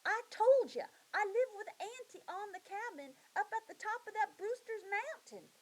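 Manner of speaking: put-on high, odd little girl talking in old words; a filthy girl from the mountain answering the search party, high; wild little girl's voice. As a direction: play the strange lost girl, high and plain-spoken. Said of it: "She goes".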